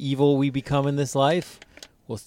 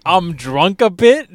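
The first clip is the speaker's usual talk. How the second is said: high, loud, drawn-out drunk imitation; mock drunk woman; 7 semitones higher, 11 dB louder, much slower than usual. Friend mimicking a hammered chick's admission, loud mock-drunk. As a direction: stretch it out, loud and high, mocking.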